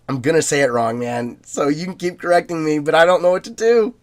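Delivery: usual speaking voice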